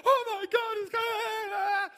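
high, frantic, half-crying wail; mock-panic, high and shouted; panicked crowd. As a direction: cry out like the world's ending. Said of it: "people were running around going".

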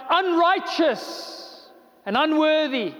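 high, slow, condemning call; accusing cry. Acted. Blood of sin crying out against the sinner, high and condemning. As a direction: drag out the accusation.

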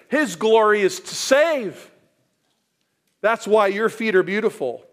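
loud, high, fervent proclamation; full-force preaching. Acted. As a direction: drive each phrase home from the pulpit. Preacher rises to climax.

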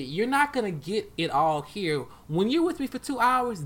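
ordinary delivery

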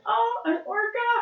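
high, excited "an orca!"; surprised exclamation. Pretend. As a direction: shriek it like spotting a whale. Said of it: "they'd be like".

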